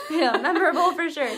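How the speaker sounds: real amused laugh. Real, not acted. Laughing as the host jokes a stage burp would be awesome.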